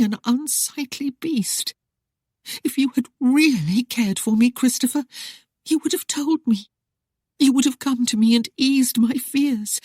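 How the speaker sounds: high, strained tearful reproach; ragged with emotion. Acted. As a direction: pour out her hurt to her husband. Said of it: "voice was ragged with emotion".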